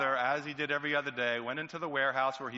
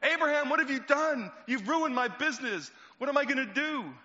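much higher and louder, outraged; angry father; 12 semitones higher, 5 dB louder than usual. Acted. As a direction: shout at him over the ruined business. Father raging at his son.

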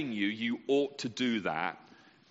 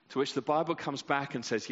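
usual speaking voice

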